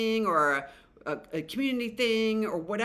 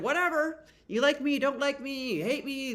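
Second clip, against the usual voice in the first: higher, breezy "whatever" shrug; careless Fool; 6 semitones higher than usual. Acted. Reader voicing the Fool brushing off critics, breezy and higher. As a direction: toss it off like nothing touches you. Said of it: "he's just like".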